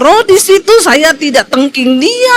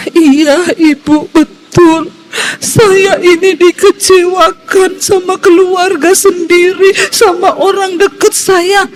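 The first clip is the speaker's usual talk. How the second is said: higher, slower, lightly tearful voice; a hint of the woman's weeping; imitated crying; 4 semitones higher, slower than usual. Woman confesses her family hurt her, mild tearful imitation. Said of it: "tiba-tiba dia nangis".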